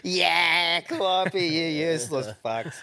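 teasing mimicry of the angry woman; mocking echo. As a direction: throw her words back at her mockingly. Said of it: "And I went".

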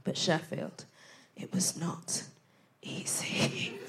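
whispered, slowing down; whispered ominous aside; hushed whisper. Acted. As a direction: drop to a secretive whisper. Dropping to a whisper.

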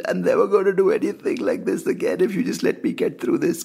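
high, pleading bargain; desperate pleading. Retold or imitated. Bargains with fate during the panic attack, high and pleading. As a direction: beg to be spared. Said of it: "I was just like".

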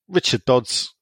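ordinary talk, nothing marked

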